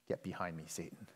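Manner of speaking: low, soft retold rebuke; quiet rebuke. Jesus turns to Peter with tough words, quiet rebuke. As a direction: drop low and hushed for the reprimand.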